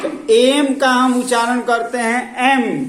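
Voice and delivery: teacher projecting loudly to a noisy class; calling out loud. Real, not acted. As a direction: raise the voice over the room.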